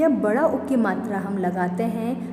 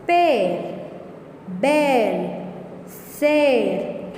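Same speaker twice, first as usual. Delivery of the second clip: classroom word drill; much slower than usual. Shown as a demonstration. Teacher drills ऐ words.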